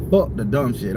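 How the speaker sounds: normal talking voice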